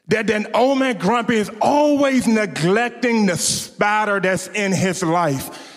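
quiet heartfelt preaching to the church; soft reflective preaching. Acted. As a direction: draw the congregation in quietly. Quiet reflective sermon application.

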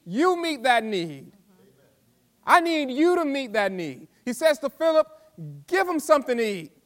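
loud, high, driving sermon voice; fiery preacher calling the church to act; full-force preaching. Acted.

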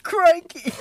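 hammed-up Australian croc-hunter exclamation; Steve Irwin Australian accent. Reviving the Steve Irwin bit, mock Australian accent. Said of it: "Call me Steve Irwin".

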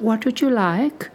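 lightly voiced old lady; a hint of a sweet old shopkeeper; very old woman. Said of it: "a very old lady".

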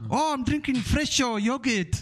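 put-on high, slow child voice with a Nigerian accent; slow, high kid voice; little Nigerian boy. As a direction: mimic the small boy answering proudly. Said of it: "little boy drinking yogurt".

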